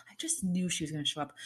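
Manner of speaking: quick hushed whisper; fast whisper of certainty. Real, not acted. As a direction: let them in on a secret. Secretive confession.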